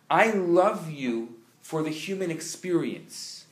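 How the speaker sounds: ordinary talk, nothing marked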